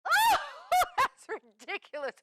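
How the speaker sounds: high burst of laughter; laughing. Acted. Laughs at Freddie.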